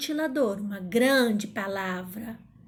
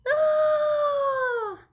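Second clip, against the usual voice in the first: long high "dooor" holler; stretched calling note; high held vowel call; 5 dB louder than usual. Shown as a demonstration. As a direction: hold the syllable way up high. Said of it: "Olha onde eu fiquei mais tempo".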